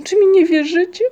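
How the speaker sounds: high, loud desperate question; acted cry of disbelief and despair. A narrator's reading. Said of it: "krzyknął z rozpaczą".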